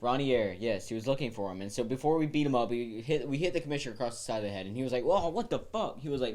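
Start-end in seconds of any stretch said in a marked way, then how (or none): 5.1-6.0 higher, louder shocked reaction; retold startled protest. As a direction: imitate a man suddenly struck. Retold commissioner reacting to blow.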